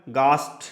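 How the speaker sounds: teacher demonstrating German गास्ट; German pronunciation. Shown as a demonstration. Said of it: "तो क्या बन गया?".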